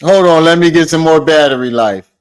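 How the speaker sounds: loud, mocking echo of an excuse; mock quoting. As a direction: throw the excuse back at them, loudly.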